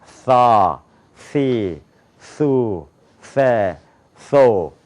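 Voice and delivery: 사, 시, 수, 세, 소 as TH, tongue between teeth; English TH. Shown as a demonstration. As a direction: bite the tongue for 사, 시, 수, 세, 소, each falling. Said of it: "혀를 깨무는 거 알죠".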